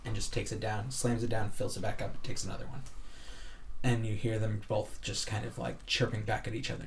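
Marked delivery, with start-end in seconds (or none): none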